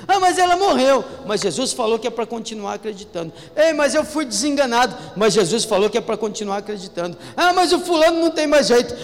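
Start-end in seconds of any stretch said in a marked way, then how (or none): 0.1-1.0 high, loud whiny objection; doubter's complaint. Pretend. Preacher mimicking a doubting believer, high and whiny. 3.6-5.0 higher, louder protest; doubter's complaint. Pretend. Preacher mimicking a hopeless believer, pitched up. 7.4-8.9 high, loud, fast whine; doubter's complaint. Pretend. Preacher mimicking a gossiping doubter, high and loud.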